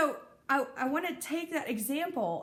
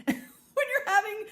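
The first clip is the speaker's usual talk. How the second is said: high laugh bursting through the words; 11 semitones higher, 5 dB louder, slower than usual. Real, not acted. Talking to viewers about the groinal, breaking into high laughter. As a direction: laugh it out in disbelief.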